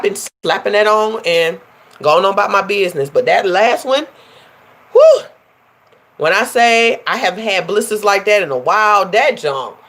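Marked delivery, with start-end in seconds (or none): 4.9-5.2 high, loud whew of remembered pain. Real, not acted. Vlogger recalling the pain, whew. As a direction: blow out at the memory.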